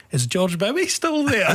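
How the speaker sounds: high, slow, laughing Glasgow woman. Retold or imitated. Glaswegian woman in Chicago asks the radio host about a colleague, high and laughing. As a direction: ask it sweet and high, already laughing.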